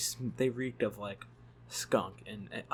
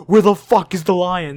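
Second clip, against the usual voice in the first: much higher, much louder alarmed shout; wide-eyed panic; 8 semitones higher, 19 dB louder than usual. Retold or imitated. Stoned man turning to his friend, wide-eyed panicked shout. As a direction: yell it wide-eyed, suddenly scared of the lion. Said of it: "his mouth opens wide, and his eyes open wide".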